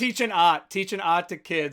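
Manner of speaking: imitated Boston accent with dropped R in art. Co-host quoting the film's actor, imitated Boston accent. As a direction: mimic the actor's Boston vowels. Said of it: "it's when he said".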